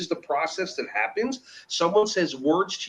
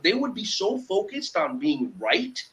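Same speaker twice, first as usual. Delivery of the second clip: usual speaking voice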